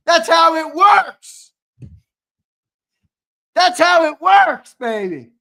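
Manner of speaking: loud, high and fired up, slowing into a shout at the end; heated rant. Real, not acted. Streamer yelling back at chat critics, loud heated rant.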